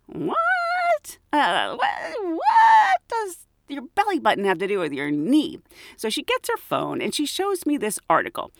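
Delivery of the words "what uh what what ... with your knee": high, baffled yelp; mock disbelief, voice jumping up; incredulous. Narrator to coworker about belly-button oil, high incredulous yelp. Incredulous reaction to odd advice.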